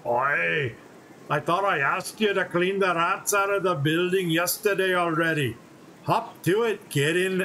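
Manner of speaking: put-on light Scottish accent for a gruff dwarf. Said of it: "I would associate Reaver to have a Scottish accent".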